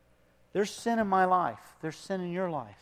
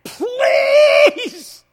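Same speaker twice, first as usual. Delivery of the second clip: high, loud screaming plea to a dying father; desperate scream; 18 dB louder, much slower than usual. Retold or imitated. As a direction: shriek it out like a son begging. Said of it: "was screaming in his face".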